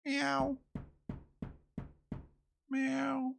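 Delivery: high mewing cat; cat meow. Pretend. Pretending to be a cat.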